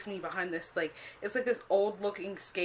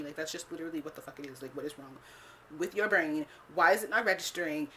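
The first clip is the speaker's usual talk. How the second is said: ordinary delivery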